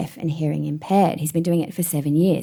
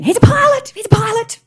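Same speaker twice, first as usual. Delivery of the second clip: much higher and louder proud mother; proud mum chiming in; 6 dB louder than usual. Retold or imitated. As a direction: chirp it like a proud mum showing off.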